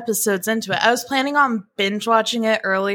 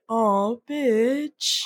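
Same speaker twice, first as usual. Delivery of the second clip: soulful sigh; 3 dB quieter than usual. Retold or imitated. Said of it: "his, like, soulful".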